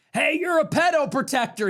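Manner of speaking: high shouted jeer, imitating a worker; retold heckle. Blue-collar worker yells at the president as he leaves, high shouted jeer.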